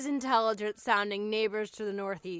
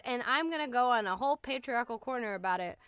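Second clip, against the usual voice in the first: ordinary delivery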